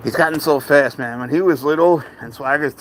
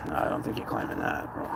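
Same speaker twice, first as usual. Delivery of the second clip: hushed, breathy whisper to the cat; 12 semitones lower, 15 dB quieter than usual. Real, not acted. Owner whispering to his cat not to climb, hushed whisper. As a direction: whisper it to him.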